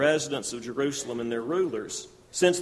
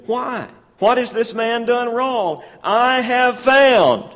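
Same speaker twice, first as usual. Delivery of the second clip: higher, louder, slowed plea; pleading Pilate; 8 semitones higher, 9 dB louder, slower than usual. Acted. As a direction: beg the crowd to see reason.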